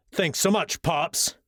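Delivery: mock the teacher with fake gratitude; eye-roll sarcasm. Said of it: "Ryan said with an eye roll".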